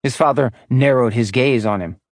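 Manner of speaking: usual speaking voice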